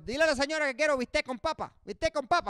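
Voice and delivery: high, fast put-on mom voice; mock Cuban mom; mom ordering in Spanish. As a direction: order briskly like a mother.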